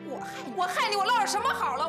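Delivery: high-pitched, hurt indignation; wronged sister's rising, bitter protest; aggrieved protest. Acted. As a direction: climb high as the grievances pile up. Sister defends herself in acted quarrel.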